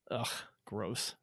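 grossed-out ugh; annoyed ugh. Real, not acted. Reacting to rejected jersey.

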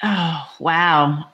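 normal talking voice

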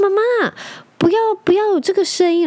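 a small son begging his snoring mom to stop; little son's voice. Retold or imitated. Mom voices her young son.

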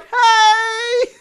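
very high, loud yelled greeting; shouted hey. Retold or imitated. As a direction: yell back from the boat deck. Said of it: "the people in the boat like".

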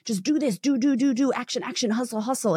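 high, pushy parody of a hustle preacher; mock hustle guru. Coach mocking hustle culture, high and pushy. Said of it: "There's like".

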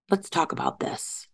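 a soft whisper to herself; whispered self-talk. Retold or imitated. Herself, soothing her own panic on the couch, in a whisper. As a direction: hush it, coaxing herself to calm down.

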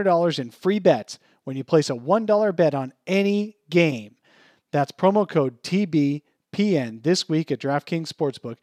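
upbeat sponsor ad read. Acted. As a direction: sell it like a radio spot. Reads a sponsor ad.

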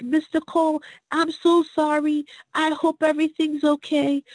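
put-on high, small child's voice, sweet and sorry; little child's voice. Kids comforting Mister Cole, in a high child's voice. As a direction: voice the little kids consoling him. Children running up consoling him.

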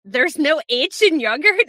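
high, loud, drawn-out disbelief; incredulous question. Retold or imitated. Immigrant questioning her husband about a spelling, incredulous. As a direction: question it slowly, loudly, astonished. Retells own disbelief at discovery.

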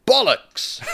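high, falling, emphatic exclamation; crisp, confident declaration; clear, with conviction. A narrator's reading. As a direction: proclaim BOLLOCKS clearly, pitched high. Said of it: "said clearly and with conviction".

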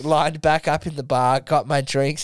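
ordinary talk, nothing marked

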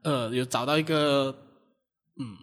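ordinary talk, nothing marked

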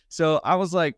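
normal talking voice

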